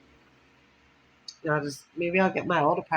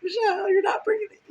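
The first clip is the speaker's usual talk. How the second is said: hammed-up scared cry, far higher; mock panic, voice cracking high; scared friend. Friend shaking her awake, in mock terror. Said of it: "He just got all scared".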